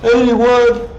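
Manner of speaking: sudden loud high call; loud correction. Real, not acted. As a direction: blurt it out loud. Correcting the floor number loudly.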